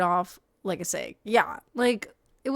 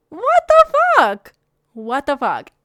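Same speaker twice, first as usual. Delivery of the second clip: outraged outburst; 7 semitones higher, 14 dB louder, slower than usual. Real, not acted. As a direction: yell it in disbelief, then come down. Outraged reaction to the retold story.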